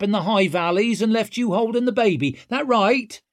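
high, cocky and loud; swaggering rogue taunting; brash young woman. A narrator's reading. Voicing Ricker character.

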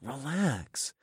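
soft whispered aside. A narrator's reading. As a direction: whisper it sideways to her. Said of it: "I whispered out of the corner".

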